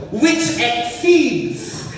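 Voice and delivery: high, loud, very slow recitation; solemn verse reading. Real, not acted. Pastor quoting the Bible on peace, solemn and slow. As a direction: savour the verse word by word.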